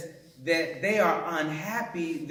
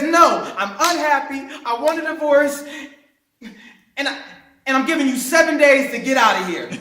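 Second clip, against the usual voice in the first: mock firm wife; 4 semitones higher, 6 dB louder, faster than usual. Wife's ultimatum in retold story.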